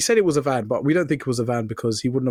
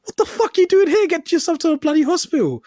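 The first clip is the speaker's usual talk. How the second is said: very high, loud incredulous outburst; retold exasperated shout; 4 dB louder than usual. Colleagues telling the injured man off, retold exasperated shout. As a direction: shout it high, imitating appalled mates.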